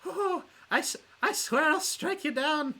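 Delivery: very high, loud character voice; Catherine. Acted. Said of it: "in my Catherine voice".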